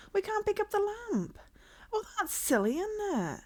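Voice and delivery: high, indignant complaint; griping at the game; exasperated. Real, not acted. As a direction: pitch it up in mock outrage. Annoyed at the broken lamp.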